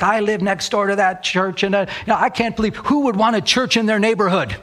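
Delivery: put-on high complaining voice; imitated objecting neighbor. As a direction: play the outraged neighbor. Retold woman objecting to the church.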